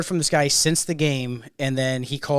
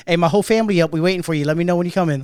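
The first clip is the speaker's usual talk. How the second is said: put-on Memphis accent; 4 semitones higher, 7 dB louder, faster than usual. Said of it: "He got a fucking accent".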